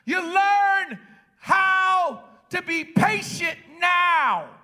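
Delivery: high, loud and slow, pressing each word; preaching shout. Real, not acted. Preacher driving home patience to the congregation, shouting slow. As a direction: shout it over the church.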